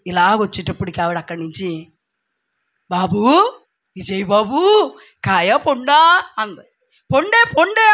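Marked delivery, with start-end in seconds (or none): 2.9-6.3 put-on old neighbour calling. 7.1-7.9 high, loud happy answer; excited reply. Retold or imitated.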